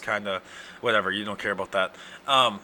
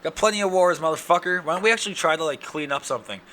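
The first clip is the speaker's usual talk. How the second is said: mocking a scolding parent; 5 semitones higher than usual. Joking to the audience, a mock scolding mom. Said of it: "they're like".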